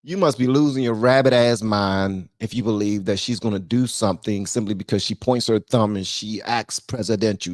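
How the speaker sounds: usual speaking voice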